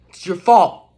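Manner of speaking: ordinary talk, nothing marked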